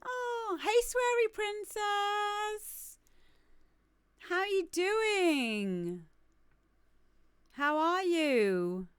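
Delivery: high, drawn-out hello; playful slow sing-song welcome; sing-song greeting. Real, not acted. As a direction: stretch each greeting out in a high voice. Greets an arriving viewer by name.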